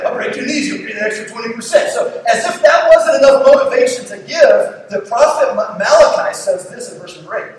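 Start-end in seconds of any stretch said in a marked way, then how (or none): 0.0-1.9 higher-pitched, joking mobster threat; mock mafia threat. Preacher replays the mafia collecting tithe, mock threat. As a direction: playfully threaten like a debt collector. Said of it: "the mafia around back in those days".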